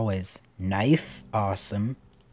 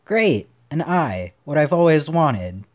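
high, loud fake enthusiasm; mock delight; 5 semitones higher, 8 dB louder than usual. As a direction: pretend it's the prize you dreamed of. Mock delight at unwanted prize.

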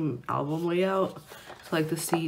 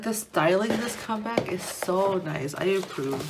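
ordinary talk

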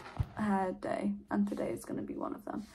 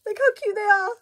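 cooing; 10 semitones higher, 10 dB louder than usual. Real, not acted. Cooing over cute decorations.

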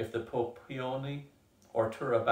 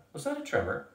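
much higher, startled question; sudden alarm; 10 semitones higher than usual. Acted.

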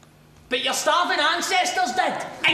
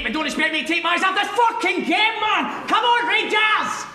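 rowdy fan shout; 3 semitones higher than usual. Acted. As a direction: yell past him at the game. Glued to game, cheering.

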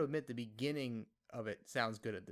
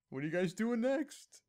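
higher, louder put-on delivery; mock dreaded question; 9 semitones higher, 5 dB louder than usual. Interviewer throwing out the dreaded question, high and loud. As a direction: pitch it up like an overeager interviewer.

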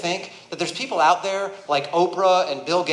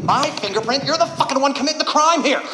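building angry rant; 6 semitones higher than usual. Retold or imitated. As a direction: let the outrage build until you're yelling at her. Ranting at the bank teller.

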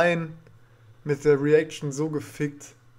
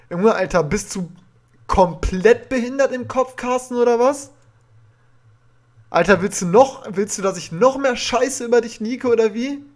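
raised, furious ranting; tearing into him, voice raised high; berserk rage; 6 semitones higher, 4 dB louder than usual. Real, not acted. Furious rant at rival streamer.

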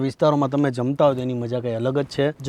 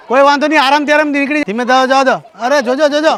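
calling out; 10 semitones higher, 3 dB louder than usual. Real, not acted. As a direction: call out over the crowd, urging care. Calling after children leaving.

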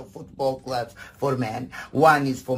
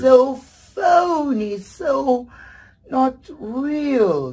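mock disgust; 13 semitones higher, 9 dB louder, much slower than usual. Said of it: "It's so fake".

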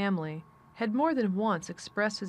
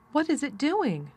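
higher, eagerly curious question; excited cry; 7 semitones higher than usual. A narrator's reading. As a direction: cry out, unable to hold back. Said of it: "cried Colin".

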